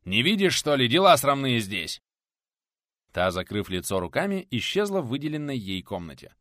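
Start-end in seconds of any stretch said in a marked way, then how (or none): 0.0-2.0 loud, higher-pitched rebuke; scolding shout. A narrator's reading. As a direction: scold the girl loudly, dragging the last words. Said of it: "Прикрикнул он на притихшую в углу девушку".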